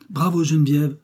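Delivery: high, bright cheer of approval; excited cry. A narrator's reading. Marguerite cheering Geneviève's refusal, excited cry. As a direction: call out bravo, thrilled. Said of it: "s'écria Marguerite".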